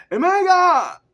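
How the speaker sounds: very high, loud mock scream; hammed-up scream. Reading what people call out in sudden danger, hammed-up scream. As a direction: shriek it way over the top. Retake of the poem's cry.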